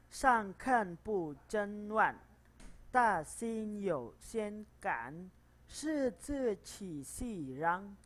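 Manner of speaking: slow model reading. Shown as a demonstration. Teacher reads the paragraph aloud as a model. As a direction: read each syllable slowly for students to copy.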